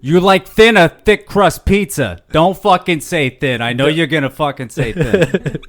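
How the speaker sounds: loud Boston pizza rant; Boston accent. Pretend. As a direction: needle them in a Boston accent. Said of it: "exactly who I was imagining when I was talking like that".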